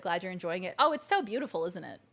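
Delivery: usual speaking voice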